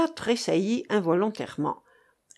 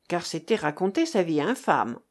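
ordinary delivery, nothing marked

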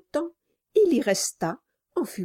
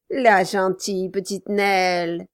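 fausse douceur; 4 dB louder than usual. A narrator's reading. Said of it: "s'écria Quilp".

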